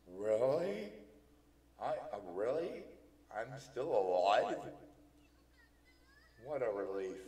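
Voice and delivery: loud, rising, incredulous joy; acted relief with rising questions; happier, relieved. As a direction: burst out in delighted disbelief. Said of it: "She's sounding a little bit happier now".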